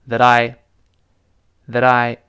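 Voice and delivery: THAT I with the T as a light tap, "tha-dai"; lightly voiced t. Shown as a demonstration. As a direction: flick the tongue lightly between THAT and I, very slowly. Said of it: "那个t会轻轻浊化". (that I的t轻轻浊化，放慢示范)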